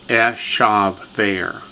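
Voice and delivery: "eshaver" slowly, each syllable given full length; teacher's slow model of "eshaver"; three full syllables. Shown as a demonstration. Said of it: "Three full syllables".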